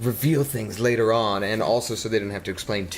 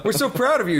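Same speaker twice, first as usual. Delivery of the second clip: much higher, gushing proud father; hammed-up proud dad; 11 semitones higher, 3 dB louder than usual. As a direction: gush like a dad filming his kid's play. Voicing proud dad in audience.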